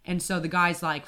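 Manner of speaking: ordinary talk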